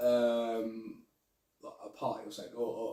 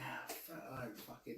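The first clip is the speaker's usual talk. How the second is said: muttered swearing; 3 semitones lower, 19 dB quieter than usual. Retold or imitated. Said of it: "just sitting there going".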